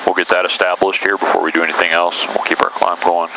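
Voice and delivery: usual speaking voice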